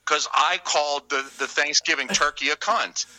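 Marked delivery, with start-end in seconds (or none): none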